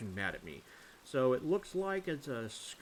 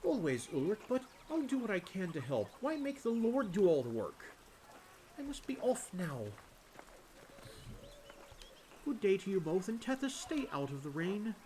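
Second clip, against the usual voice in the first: higher game character voice; determined villager; 9 semitones higher than usual. Acted. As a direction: lift the voice for a kindly townsperson. Voices villager taking leave.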